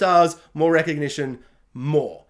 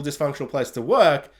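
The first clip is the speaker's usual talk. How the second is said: usual speaking voice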